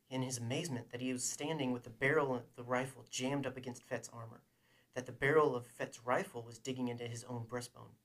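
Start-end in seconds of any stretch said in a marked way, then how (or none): none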